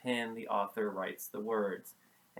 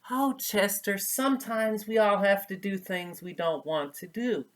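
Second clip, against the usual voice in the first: higher, louder, gentle motherly comfort; 7 semitones higher, 6 dB louder than usual. Acted. Mother raccoon comforts scared son before school, gentle and high. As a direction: soothe him like a caring mother raccoon. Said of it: "she told him gently".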